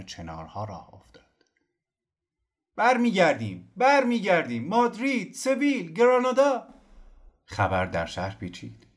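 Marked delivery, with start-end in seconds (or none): 2.8-3.7 high, loud cry of joy; joyful shout. Acted. Exiles cheer news of return. 3.8-4.7 high, loud cry of joy; joyful shout. Acted. Exiles cheer news of return. 4.7-6.7 high, loud city names cried out; joyful shout. Acted. Exiles cheer news of return.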